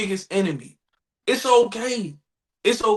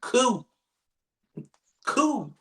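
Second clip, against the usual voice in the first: detached cool; 5 dB quieter than usual. Retold or imitated. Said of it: "I sit back and I'm just like".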